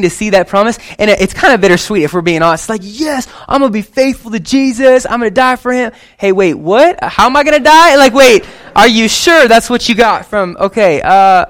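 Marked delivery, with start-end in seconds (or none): 2.8-5.9 high, cheery pledge of loyalty; a hammed-up high eager vow; eager mock pledge. Imagined eager disciple voice. 6.2-11.5 high, loud comic double take; hammed-up shocked squeak, louder; mock alarm. Imagined panic at the prophecy.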